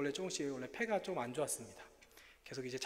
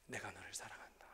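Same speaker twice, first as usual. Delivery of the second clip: lower, unvoiced loving whisper; God's whisper; 7 semitones lower, 10 dB quieter than usual. God answering the sick pastor, lower hushed whisper. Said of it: "하나님 제게 말씀해 주신".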